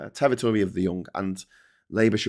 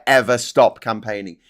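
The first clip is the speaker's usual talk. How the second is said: ordinary delivery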